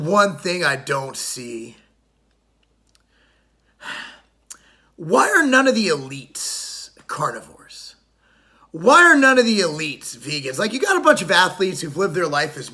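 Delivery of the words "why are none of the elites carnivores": slow, hushed whisper of a secret question. Acted. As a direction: lean in and whisper it like a conspiracy. Rhetorical question to the audience.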